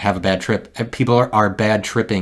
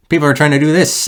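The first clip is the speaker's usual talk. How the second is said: higher, louder frustrated burst; exasperated outburst; 7 semitones higher, 7 dB louder than usual. Real, not acted. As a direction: blurt it out in exasperation. Ranting about standards, voice jumps.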